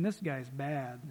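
normal talking voice

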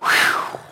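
a breathy relieved whew. Retold or imitated. The Corinthians react to verse eleven with a relieved whew. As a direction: let the air out in relief. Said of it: "You can see the Corinthians go".

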